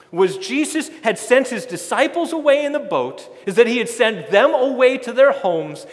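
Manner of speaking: loud, building sermon delivery; full-force preaching. Acted. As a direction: preach it out to the whole congregation.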